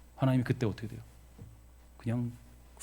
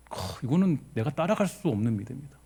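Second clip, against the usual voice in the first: normal talking voice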